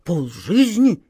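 startled question, a jolt of shock; startled echo of the words. A narrator's reading. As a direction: flinch at the words. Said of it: "вздрогнул рыжий нос".

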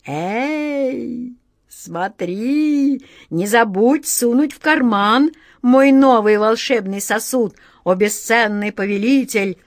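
self-satisfied genie, rising and stretching the opening words; very pleased genie. A narrator's reading. Genie reminding his master about the vessel, very pleased. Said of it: "Очень довольный напомнил Джин".

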